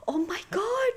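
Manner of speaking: high gasp into a high cry; stunned high-pitched gasp; shocked gasp. Real, not acted. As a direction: gasp at the dragon on screen. Shocked by the dragon.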